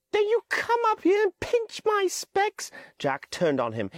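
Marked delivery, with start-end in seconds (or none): 0.1-2.7 put-on high, pleading boy voice; high-pitched anxious boy Piggy; Piggy's voice. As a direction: voice the fretful schoolboy Piggy, pitched well up. Piggy complains.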